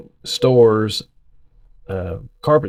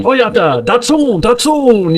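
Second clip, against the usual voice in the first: high, hammed-up fake foreign accent, drawn out; grandpa's mock accent; 11 semitones higher, 4 dB louder, slower than usual. Grandson imitating grandpa reading car names in a put-on accent. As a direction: mimic grandpa mimicking an accent. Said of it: "he would try to do the accent".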